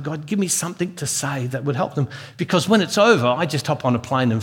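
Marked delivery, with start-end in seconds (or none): 0.0-2.1 softly repeated private prayer; a hushed plea to God, much softer; under his breath. Retold or imitated. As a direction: pray it quietly to yourself. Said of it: "saying it just under my breath".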